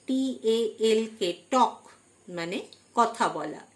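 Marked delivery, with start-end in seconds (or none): none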